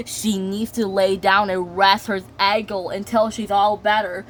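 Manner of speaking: ordinary delivery, nothing marked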